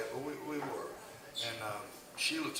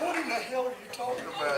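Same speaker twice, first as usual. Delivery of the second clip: high, startled what-the-hell; mock indignant outburst; mock outrage; 8 semitones higher, faster than usual. Reacting to wrong name.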